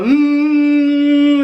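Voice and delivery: long, high imitated motor buzz; drawn-out buzzing "mm"; vibrator hum. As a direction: hum loudly like a small motor.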